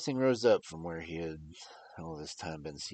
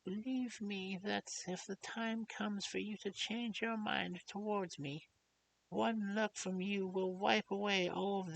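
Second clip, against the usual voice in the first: full of pity. Acted. Old doctor pleads kindly with the grieving young lord. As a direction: beg him gently, heart aching. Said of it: "with an accent full of pity".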